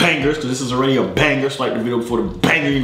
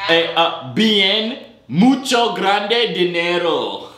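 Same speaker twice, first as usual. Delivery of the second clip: slow, high broken Spanish; mock Spanish accent; 5 semitones higher, much slower than usual. Clowning in broken Spanish to stranger.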